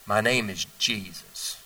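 slow, low, soft and knowing; young Jesus answering the teachers slowly and softly; calm young Jesus. Retold or imitated. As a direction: lay it out gently, unhurried, like a wise child. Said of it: "He said".

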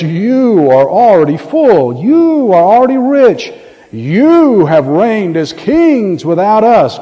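drawn-out mock praise, leaning on YOU; slow, emphatic sarcasm to burst their bubble; sarcastic. Paul to puffed-up believers, biting sarcasm. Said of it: "He's being very sarcastic".

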